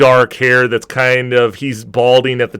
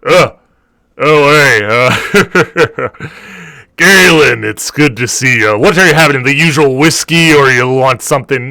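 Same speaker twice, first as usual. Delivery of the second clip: orc bartender voice; 2 semitones higher, 5 dB louder than usual. Acted. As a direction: greet an old regular across the bar. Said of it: "He looks at you and goes".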